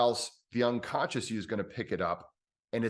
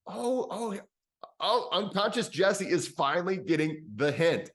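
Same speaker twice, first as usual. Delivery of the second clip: pleased realization; 8 semitones higher than usual. Pretend. Relief as the unconscious responds.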